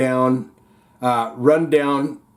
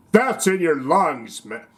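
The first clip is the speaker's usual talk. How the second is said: commercial voice; 2 semitones higher than usual. Retold or imitated. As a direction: read it like a TV spot tagline. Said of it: "I'm still in the commercial voice".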